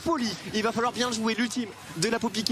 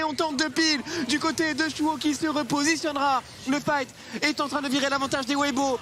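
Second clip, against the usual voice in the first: higher, urgent play-by-play; esports caster; 8 semitones higher than usual. Acted. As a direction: call the teamfight as it swings. Teamfight casting.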